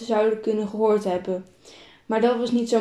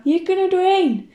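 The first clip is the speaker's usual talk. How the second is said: higher, louder call from the bushes; 8 semitones higher, 4 dB louder than usual. A narrator's reading. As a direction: call the others over to the gap. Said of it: "Vanuit de struiken roept Ava". (roepend vanuit de struiken)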